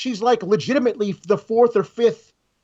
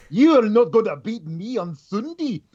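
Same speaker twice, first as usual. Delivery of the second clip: imitating Scottish accent; 3 semitones higher than usual. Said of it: "I love her Scottish, uh, Scottish accent".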